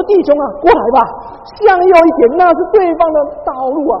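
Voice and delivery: loud, high, fast pleading to the driver; panicked plea. Retold or imitated. Preacher begging the driver to steer back, loud panicked plea. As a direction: beg him to pull over right, in a comic panic.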